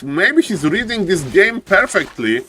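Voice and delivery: usual speaking voice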